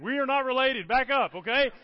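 irritated retort. Retold or imitated. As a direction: push them away with mock indignation. Irritated retort to being called brother.

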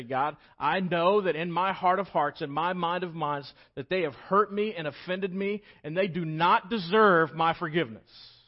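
rising prayerful plea; fervent prayed confession. Acted. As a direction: cry it out to God. Voiced prayer inside sermon.

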